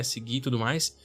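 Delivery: normal talking voice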